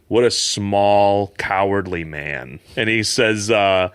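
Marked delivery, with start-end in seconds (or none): 0.1-2.6 slow contempt. Retold or imitated. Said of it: "And he goes".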